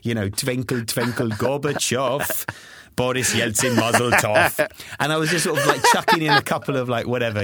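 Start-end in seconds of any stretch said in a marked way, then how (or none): 0.3-4.7 slow, hammed-up Russian accent. Parent improvising a bedtime rhyme, mock Russian accent. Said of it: "Russian references".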